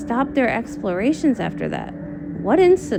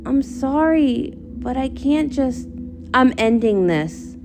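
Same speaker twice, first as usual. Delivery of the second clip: normal talking voice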